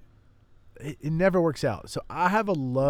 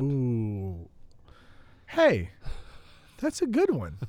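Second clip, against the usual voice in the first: mock surprise; slower than usual.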